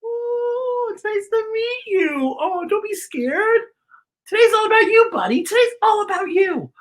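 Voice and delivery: high cooing voice to a pet. Real, not acted. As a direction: coo at the little dog, way up high. Greeting a pet with baby talk.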